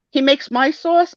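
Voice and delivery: high, slow questioning mom; imitated mother. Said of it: "She goes".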